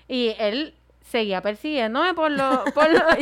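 normal talking voice

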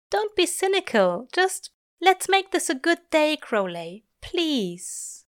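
high-pitched pleading character voice; Aziraphale, pleading. A narrator's reading. Angel asking Crowley for a good day, pleading. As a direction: coax him in a high voice.

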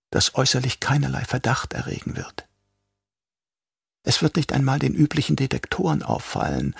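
soft, quietly fanatic Yui; lightly voiced traitor doctor. A narrator's reading. Yui explains the poison tooth.

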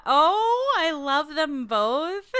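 high, loud, drawn-out gushing; gushing adoration. Real, not acted. Co-host adoring two devoted listeners, gushing. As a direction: coo loudly, stretching it out.

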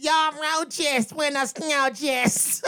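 high, very slow buenas noches; mocking drawn-out goodbye. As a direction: stretch it out, laughing. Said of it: "the way you said it".

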